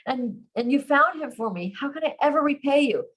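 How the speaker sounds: high, gushing gratitude; growing excitement. A narrator's reading. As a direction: rush on with overwhelmed, excited thanks. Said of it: "his increasing excitement".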